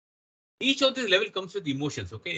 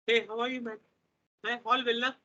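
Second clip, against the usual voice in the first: imitating Sachin; 6 semitones higher than usual. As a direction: mimic a gentle cricketer's easy greeting. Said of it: "I'm just imitating Sachin".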